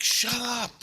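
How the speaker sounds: high-pitched exasperated retort; retold irritation. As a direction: act out snapping at a nag. Retells snapping at screaming priestess.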